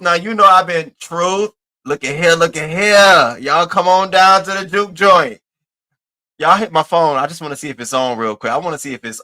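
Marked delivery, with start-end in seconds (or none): 2.3-5.4 stretched, louder hostess call, up high; hammed-up invitation to the juke joint; singsong juke joint call. Calling people into the stream.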